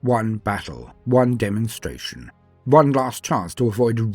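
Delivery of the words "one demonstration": ordinary delivery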